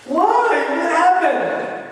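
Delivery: very high, loud questioning; drawn-out, high-pitched mock alarm; alarmed reaction. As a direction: act out an alarmed husband on the phone. Acting his reply on the call.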